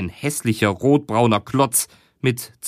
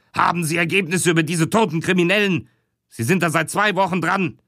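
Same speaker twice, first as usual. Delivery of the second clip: higher, fast nervous boss; agitated boss; 6 semitones higher, faster than usual. Acted. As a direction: rush it high like a harried chief. Agitated boss questions detectives.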